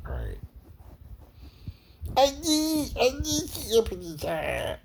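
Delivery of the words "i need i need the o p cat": high, whiny cartoon bird voice; squeaky little bird, close to crying; Tweety Bird. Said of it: "impersonating who? Tweety Bird".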